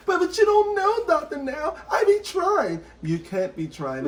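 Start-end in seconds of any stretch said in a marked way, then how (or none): 0.1-2.8 high, whiny excuse-making voice; mock a pleading patient, pitched way up. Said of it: "they be like".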